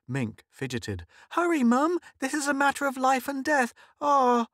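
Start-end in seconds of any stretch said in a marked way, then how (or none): 1.3-4.5 put-on very high, loud little-girl urgency; impatient child. A narrator's reading. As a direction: whine at mum as if desperate to go. Fidgeting child urges her mother.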